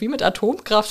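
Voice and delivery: ordinary delivery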